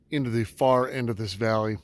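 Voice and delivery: ordinary talk, nothing marked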